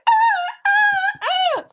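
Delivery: hammed-up wailing sobs. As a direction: overdo the sobbing like a melodrama. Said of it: "And she starts crying".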